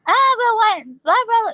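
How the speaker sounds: high mock whining; high nasal pitch. Ike protesting to Wyatt, high nasal mockery. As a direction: babble high through the nose, imitating him. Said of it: "high, high pitch nasally".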